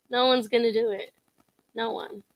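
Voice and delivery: ordinary delivery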